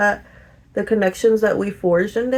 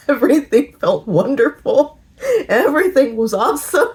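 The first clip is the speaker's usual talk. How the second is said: giggling through the words; gushing, laughing as she recalls it; tipsy giggly gush; 5 semitones higher than usual. Retold or imitated. Giddy drunk recollection, laughing.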